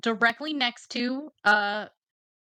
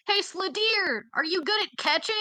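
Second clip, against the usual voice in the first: yelling across the room; 7 semitones higher than usual. Acted. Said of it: "I'm just gonna shout across the room".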